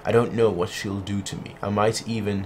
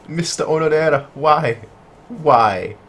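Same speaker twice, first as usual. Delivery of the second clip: louder, raised plea; loud anguished plea; 5 semitones higher, 6 dB louder than usual. Acted. Heroine begging Mister Onodera, voiced loudly. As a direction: cry out the name as a plea.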